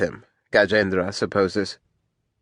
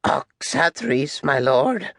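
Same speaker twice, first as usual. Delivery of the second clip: higher, meek voice rising at the end; cowed captain; 5 semitones higher, much slower than usual. Acted. Captain answers from the dirt, cowed. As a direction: answer meekly, lifting the last word.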